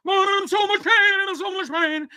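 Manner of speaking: high, sustained mock-robotic wail; Boogie through vocoder. Co-host imitating an edited meltdown clip, high vocoder wail. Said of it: "they put a vocoder on Boogie's".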